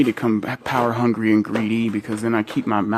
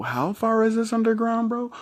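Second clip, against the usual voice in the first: higher, wide-eyed mock amazement; amazed question; 11 semitones higher than usual. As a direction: ask it like you can't believe it. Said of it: "I'm all like".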